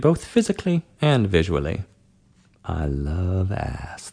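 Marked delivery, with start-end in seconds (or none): 2.7-4.1 very slow, low, soft murmur; slow low drawl. A narrator's reading. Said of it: "the voice said again".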